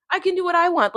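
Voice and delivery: defiant outburst reclaiming her life; emphatic repeat. Real, not acted. Insisting on her own choices.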